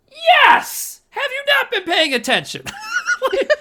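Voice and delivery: laughing mock outrage at a character; mock exasperated shout. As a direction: yell at the screen in disbelief.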